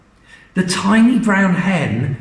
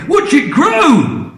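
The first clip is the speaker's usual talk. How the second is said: high, slow, louder cry; excited shout; 13 semitones higher, 4 dB louder, slower than usual. Acted. Mister Cranky cheering on the hen, slow high shout. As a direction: crow it out triumphantly. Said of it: "shouted Mister Cranky".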